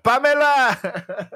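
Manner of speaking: high, slow drawn-out yell of the name; calling out. Retold or imitated. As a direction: shout the name across a room. Calling out Pamela like Borat.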